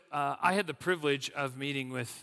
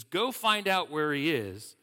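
loud, slow, higher command; royal order; 4 semitones higher, 8 dB louder, slower than usual. A narrator's reading. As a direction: give the order as an angry king. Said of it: "the king ordered".

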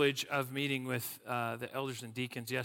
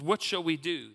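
higher, louder worried question; freaking out; 5 semitones higher, 6 dB louder than usual. A narrator's reading. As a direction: ask as a mildly panicked servant. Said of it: "He's freaking out".

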